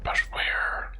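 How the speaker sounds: real unvoiced whisper; whispering to stay unheard. Real, not acted. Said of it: "Why did I whisper?".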